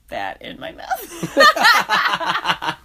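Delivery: high, laughing through the words. Real, not acted. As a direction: giggle the words out. Laughing at chunky things.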